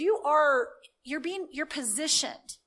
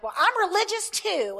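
livid retort; 7 semitones higher, 5 dB louder than usual. Retold or imitated. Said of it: "She was so livid".

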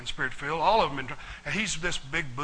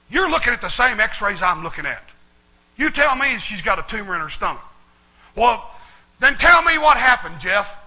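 rising louder and higher to the end; heated retort; 5 semitones higher, 9 dB louder than usual. Retold or imitated. Jeff arguing with the doctor, getting louder and higher. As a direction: demand an answer, building hot.

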